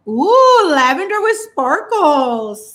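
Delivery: high, loud, slow gushing over sparkles; delighted ooh. Real, not acted. Seeing the lavender polish, high loud delight. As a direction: squeal with delight at the colour.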